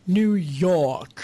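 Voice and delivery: a hint of a New York drawl on "New York"; light New York accent imitation; mock New York accent. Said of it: "not making fun of anyone's New York accent".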